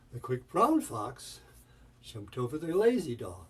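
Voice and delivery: BROWN and LAZY each swooping up and back down; rise-fall emphasis. Shown as a demonstration. Teacher emphasizing the colors, rise-fall swoop on "brown" and "lazy". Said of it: "rise and fall of the melody".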